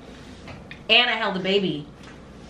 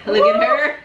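laughing; 11 semitones higher, 4 dB louder than usual. Real, not acted. Laughing at friend eating spicy.

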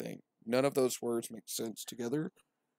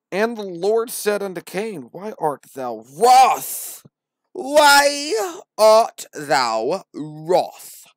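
much higher, louder, very slow divine boom; booming Lord; 9 semitones higher, 14 dB louder, much slower than usual. Acted. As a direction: thunder it from the clouds. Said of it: "The Lord didn't say that".

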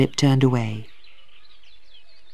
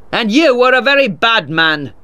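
raised, indignant retort; angry shout; 6 semitones higher than usual. A narrator's reading. Tulliver answers the lawyer's insult, angry shout. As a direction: throw the insult back, pitched up. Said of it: "Tulliver shouted".

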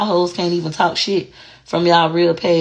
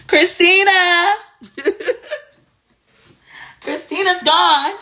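calling out; slower than usual. Pretend. Mocking her old nice self, slow high call. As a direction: call her like she's far away.

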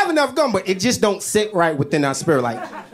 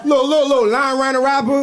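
higher, louder outraged pointing-out; excited; 11 semitones higher, 6 dB louder than usual. Real, not acted. Showing viewers the torn wrapper, loud and excited. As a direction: urgently point at the evidence.

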